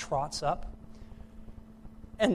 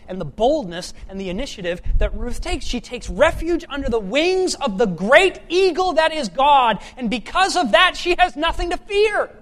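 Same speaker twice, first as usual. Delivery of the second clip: loud, high-pitched preaching, slowing on the last plea; fiery preaching; 10 semitones higher, 13 dB louder than usual. Acted. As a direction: thunder it at the congregation. Preaching climax.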